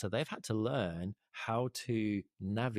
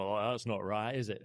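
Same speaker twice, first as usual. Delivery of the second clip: a quick northern English voice for the grumbling pub men; lightly done northern English grumble; northern English accent. Retold or imitated. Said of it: "that was a northern accent".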